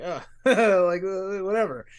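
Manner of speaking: retold stoned giggle, laugh breaking in; giggly. Retelling a fit of giggles at a commercial, giggly. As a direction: giggle through a dismissive line. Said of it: "I got, like, giggly".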